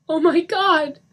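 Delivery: high, loud cry. A narrator's reading. Lisa over the corpse, crying out. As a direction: cry it out in anguish. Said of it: "Lisa cried".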